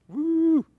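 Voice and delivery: high excited whoop. Real, not acted. Preacher whooping at the congregation, high woo. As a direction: let out a quick high woo.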